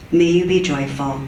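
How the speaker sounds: ordinary delivery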